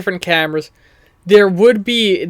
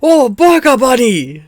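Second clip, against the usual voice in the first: put-on excited Polish accent; 7 semitones higher, 4 dB louder, slower than usual. Polish man greets the delivery.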